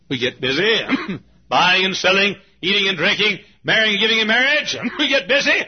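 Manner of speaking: loud, high preaching build. Acted. Preacher warning the church about busyness, loud and high. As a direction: drive it home to the congregation.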